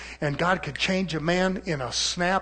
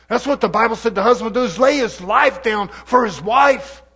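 loud, high, fiery preaching; rising gospel fervour on one phrase; preaching at full force; 5 semitones higher, 9 dB louder than usual. Acted. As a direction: lift it into a full-voiced sermon cry. Preacher builds to a peak.

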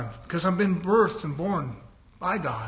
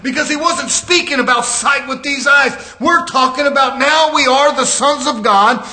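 raised, loud pulpit delivery; full-force preaching; 6 semitones higher, 7 dB louder than usual. Real, not acted. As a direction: drive each phrase out to the congregation, high and loud.

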